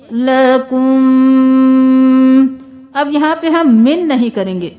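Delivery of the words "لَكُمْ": slow melodic tajweed chant; Quranic recitation. Shown as a demonstration. Teacher chanting the verse for students, slow tajweed.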